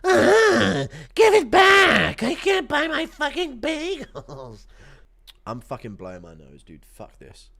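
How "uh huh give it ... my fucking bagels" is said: high, drawn-out whining victim wail; hammed-up bullied kid begging for his lunch money back, fading at the end; Ariel. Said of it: "Impression of Ariel getting his lunch money stolen".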